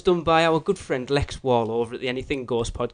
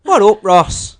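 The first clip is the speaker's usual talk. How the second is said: hammed-up Irish accent, higher and louder; louder mock Irish with a laugh in it; bad Irish accent; 8 semitones higher, 10 dB louder, much slower than usual. Lee retrying the shout-out in mock Irish accent. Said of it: "That was a bad Irish accent".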